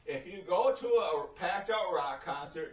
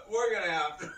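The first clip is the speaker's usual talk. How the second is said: real laughter breaking up the words; can't stop laughing; 3 semitones higher, faster than usual. Real, not acted. Storyteller quoting himself to the nurse, helplessly laughing. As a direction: try to say it, crack up. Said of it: "I can't tell this story without laughing".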